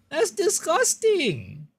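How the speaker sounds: very high, slow, drawn-out revulsion; exaggerated disgust. Real, not acted. Streamer reacts to gross cleaning videos, exaggerated disgust. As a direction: stretch it out high, thoroughly grossed out.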